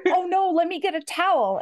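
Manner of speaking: high, flustered apology voice; a panicky, eager-to-fix-it yelp; flustered man. Acted. Clumsy hero spilled champagne.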